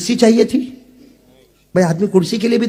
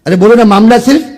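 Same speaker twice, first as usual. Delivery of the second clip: ordinary talk, nothing marked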